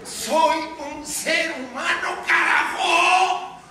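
high, drawn-out defiant declaration; impassioned cry. Acted. Anchor showing viewers what to declare, impassioned cry. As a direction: build to a full shout. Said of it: "Ustedes tienen que decir".

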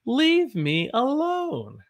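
high, slow, drawn-out whine; whiny high plea. Shown as a demonstration. Teacher acting out the phrase for students, high whine. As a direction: stretch it out like a sulky kid.

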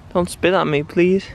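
normal talking voice

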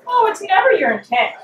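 high, scornful sneer; mock her excuse with a lofty sneer. Hecate scorning Pomona's excuse, high sneer. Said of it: "Hecate sneered".

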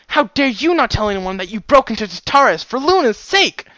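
high, loud, furious yell; roaring right back. A narrator's reading. Daughter roaring back at her father, furious high shout. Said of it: "she roared right back".

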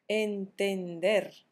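measured teacher's dictation of a new word; drawn-out, carefully separated syllables; slow, syllable by syllable. Shown as a demonstration. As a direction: spell it out slowly by syllables. Teacher slowly presents the verb.

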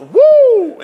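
loud high whoop; excited whoop. Real, not acted. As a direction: let out a loud, high woo.

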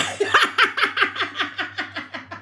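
high breathy laugh; giggle. Real, not acted. At her own joke, breathy giggling. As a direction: giggle helplessly.

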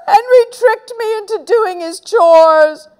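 high, loud tattling wail; wailing complaint. Acted. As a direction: wail it out to get a brother in trouble. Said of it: "wailed Peter".

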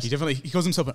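ordinary talk

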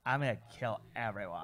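understated mimicry of a threatening wrestler; Chyna impression. Host joking about Chyna's voice, low mock threat. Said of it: "was not someone who's like".

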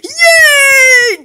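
high, loud happy cheer; excited cheer. Acted. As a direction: cheer like a thrilled kid. Kid cheering about tooth fairy.

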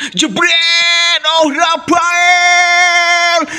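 long drawn shouted goal call. Acted. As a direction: roar the goal like a commentator.

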